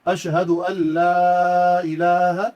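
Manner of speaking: لا drawn out for two to three alifs, slow chanted; long madd on la. Shown as a demonstration. Teacher shows the correct recitation, la stretched long. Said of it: "پڑھنے کا طریقہ یہ ہے".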